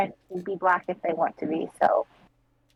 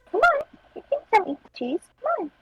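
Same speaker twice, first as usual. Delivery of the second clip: very high quick coaxing; faster than usual. Real, not acted. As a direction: coax the cat up, high and fast. Coaxing cat.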